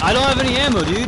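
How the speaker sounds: frantic high yell; panicked shout for help; panicking gamer. Real, not acted. Out of ammo, enemy attacking.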